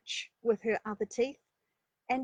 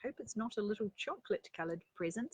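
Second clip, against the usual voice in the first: plain reading voice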